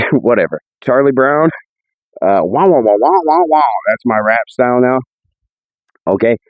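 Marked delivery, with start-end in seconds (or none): 2.2-3.6 Peanuts teacher wah wah voice; trombone-like wah wah babble; muffled wah wah talk. Pretend. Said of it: "teacher from, the Peanuts? Charlie Brown?".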